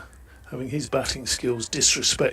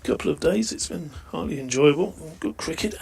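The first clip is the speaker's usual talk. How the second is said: ordinary talk, nothing marked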